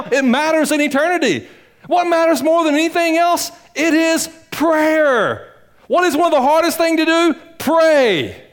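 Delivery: impassioned preaching. Acted. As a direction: hammer it home to the congregation. Preaching at full force.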